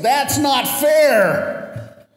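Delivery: high, drawn-out shouting protest; howled protest. Retold or imitated. Students protesting their failing grade, howling. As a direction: howl it in outrage. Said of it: "The students howled in protest".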